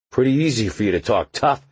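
sneering taunt; mock her toughness; scornful. A narrator's reading. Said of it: "the man said scornfully".

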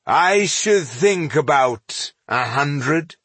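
slow, indifferent answer; bored. A narrator's reading. Dwarf answers grieving elf, bored. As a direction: draw it out as if you couldn't care. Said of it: "In a bored voice, the dwarf answered".

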